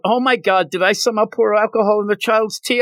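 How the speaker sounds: high, rushed mock panic; mock freak-out. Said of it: "Yor freaks out".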